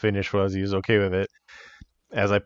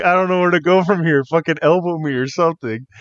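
high, loud mock panic; amused, helpless urging; retold flustered pleading; 8 semitones higher, 5 dB louder than usual. Wrestler recounting asking opponent for help mid-match, flustered.

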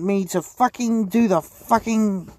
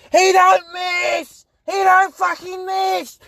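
slow, much higher shout; hype shout; 12 semitones higher, 4 dB louder, slower than usual. Acted. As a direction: bellow it like a hype man. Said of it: "And then there's".

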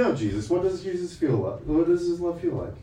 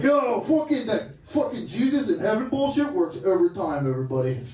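mock hyped-up hustler; 6 semitones higher than usual. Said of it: "they go into the back and they're just railing lines".

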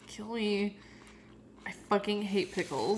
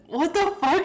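amazed; 9 semitones higher, 7 dB louder than usual. Real, not acted. Stunned by sweet lime.

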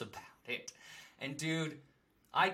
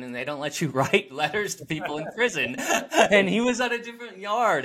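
laughing; 7 semitones higher, 9 dB louder than usual. Real, not acted. Laughing at his own story.

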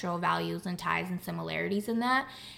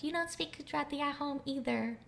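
higher, softer teasing parent voice; mock parents; 7 semitones higher, 8 dB quieter than usual. Daughter imitating her parents teasing her, higher and softer. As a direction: playfully imitate scolding parents. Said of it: "they'll be like".

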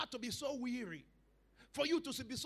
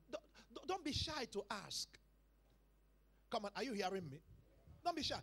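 ordinary delivery

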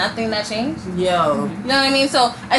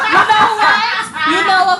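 laughing excitement; 9 semitones higher, 5 dB louder than usual. Real, not acted.

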